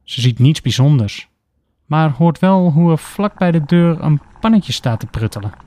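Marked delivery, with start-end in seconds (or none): none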